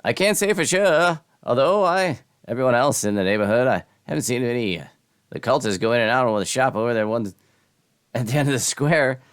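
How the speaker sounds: high, loud theatrical townsperson; wandering stranger. Acted. Voices wanderer in game.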